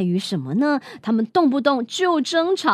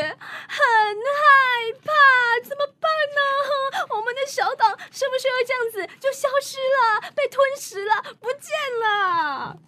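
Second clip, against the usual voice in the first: much higher, trembling fearful voice; frightened frogs; 11 semitones higher than usual. Acted. The frogs fear the rising flood, high and frightened. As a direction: sound scared the island will vanish.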